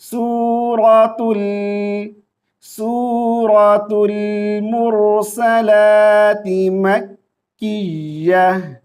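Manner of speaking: सूरतुल said slowly, then मुरसलाति drawn out; careful teacher repetition; repeating the surah name slowly for students; slow repeat demo. Shown as a demonstration. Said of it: "दोबारा से".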